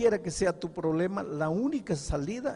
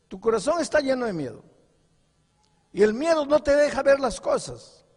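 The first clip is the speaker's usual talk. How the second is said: higher and louder, pressing each line; fiery preaching; 8 semitones higher, 9 dB louder than usual. Acted. Pastor warns the congregation about lies, fiery preaching. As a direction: preach it hard, warning the flock.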